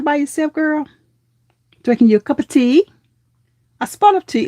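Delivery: tea-party British, lightly put on; mock British accent. Teasing a viewer about her tea, in mock British. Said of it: "That is, like, British, I think".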